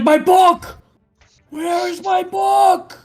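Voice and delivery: high frantic yelling; panicked shout. Acted. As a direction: screech it in a panic. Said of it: "He's like".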